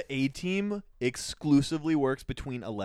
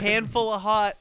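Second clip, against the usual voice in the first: loud, raised call to the kitchen; imitated yell; 8 semitones higher, 10 dB louder than usual. Worker turns to coworker for hot sauce, yelling. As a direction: holler it like the worker passing an order. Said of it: "He turns around and yells".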